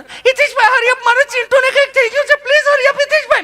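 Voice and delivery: high, loud mock panic; mimicking frantic mother. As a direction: shriek it out like a scared mum.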